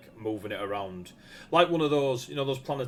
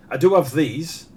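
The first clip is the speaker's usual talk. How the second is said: higher and louder, the blacksmith showing his wares; louder, higher smith voice offering blades; eager smith; 6 semitones higher, 11 dB louder than usual. Acted. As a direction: pitch it up, a keen smith pushing his blades.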